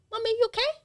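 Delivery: put-on high little child asking mommy; squeaky toddler checking on mum. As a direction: mum imitating her little girl's worried question. Mum voices toddler daughter.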